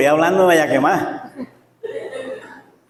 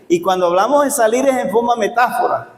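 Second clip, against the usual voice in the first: normal talking voice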